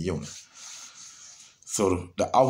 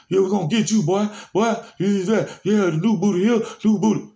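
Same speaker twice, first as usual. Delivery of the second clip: high, loud jeering yell; mock inmates taunting; 12 semitones higher, 6 dB louder than usual. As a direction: holler like inmates taunting the new guy. Inmates taunting again, retold.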